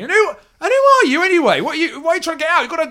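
high, loud, fast questioning guard; guard quizzing the party on why they're leaving; suspicious guard. Acted. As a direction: interrogate the strangers in a squeaky voice.

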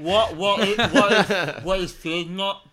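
talking through a mouthful; words round a bite of sausage; mouth full. Pretend. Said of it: "I say through a mouthful of sausage".

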